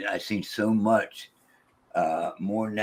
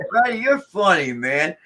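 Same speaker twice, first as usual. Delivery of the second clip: laughing; 7 semitones higher, 5 dB louder than usual. Retold or imitated. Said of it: "I started laughing".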